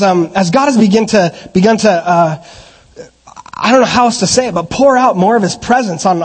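ordinary talk, nothing marked

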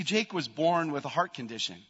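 ordinary talk, nothing marked

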